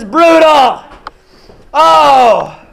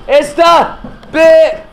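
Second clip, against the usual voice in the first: slow, suspenseful name reveal; ring announcer intro; slower than usual. Pretend. Announcer teasing the next wrestler, slow dramatic build. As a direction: hold back the name for effect.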